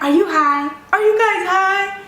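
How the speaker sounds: high, loud mock accusation; high loud teasing question; loud accusing. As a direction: shout the question at them. People loudly accusing at the table.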